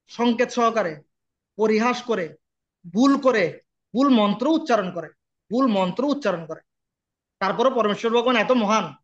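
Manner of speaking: normal talking voice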